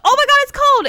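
mock squeal. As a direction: squeal it like a parody. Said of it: "It wasn't like".

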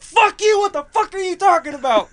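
high, loud outraged yelling; retold screaming match. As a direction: act out dad screaming back. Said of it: "we just screamed at each other".

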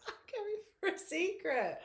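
put-on very high little-kid voice; imitated child. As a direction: echo a small child, pitched right up. Echoes the co-host's child impression.

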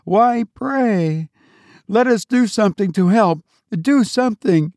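high, fast frantic plea; frantic pleading. A narrator's reading. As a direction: beg in panic to act. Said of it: "frantic with her bewildered terror".

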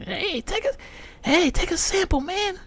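high, eager salesman voice calling out; pushy sample guy. Retold or imitated. Mocking the liquor store guy who pushes free samples, high and eager. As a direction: mimic the guy pushing free tequila samples. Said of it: "he's giving out samples of".

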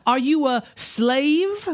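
high, very slow Southern drawl; hammed-up Southern lady, stretched out; mock Southern accent. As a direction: drawl the question like a Southern belle. Keeps up the mock Southern belle.

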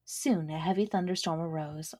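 usual speaking voice

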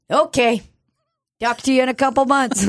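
high-pitched, put-on phone farewell; mock breezy sign-off. Clinic caller putting off client, mock breezy. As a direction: chirp it like a dismissive caller. Imitated caller brushing her off.

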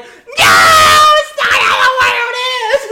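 teacher sobbing and screaming at the student over his dark skit; crying shout. Retold or imitated. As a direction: wail it like a distraught teacher crying mid-scolding. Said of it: "screaming at me".

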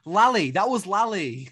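loud, high burst of recognition; emphatic exclamation. Real, not acted. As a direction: blurt out the name, loud and high. Exclaims the answer to co-host's question.

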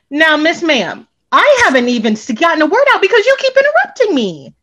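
high-pitched exasperated telling-off; retold indignant comeback. Accused speaker tells off the interrupting friend, indignant. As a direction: protest hotly, voice climbing high then easing down. Retelling her comeback in the quarrel.

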